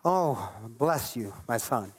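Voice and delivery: very low, slow, lofty blessing; spiritual arrogance. Acted. Holy man condescending to a lesser soul, mock spiritual arrogance. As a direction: put on a deep, drawn-out holy superiority. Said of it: "like, a spiritual arrogance".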